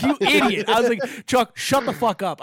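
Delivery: high, loud telling-off; frantic loud scolding. Retold or imitated. Comic yells at his friend beside the cop, high and loud.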